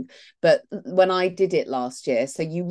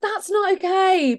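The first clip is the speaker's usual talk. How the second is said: high protest; 12 semitones higher, slower than usual. Retold or imitated. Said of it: "and I'm like".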